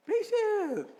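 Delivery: very high, drawn-out comic cry; comic exclamation. Acted. Preacher joking about patience with drivers, comically high-pitched. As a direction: squeal it out through the laughter.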